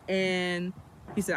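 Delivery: usual speaking voice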